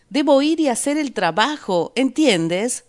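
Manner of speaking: high, loud insistent shout. A narrator's reading. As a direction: shout it at your wife, insisting.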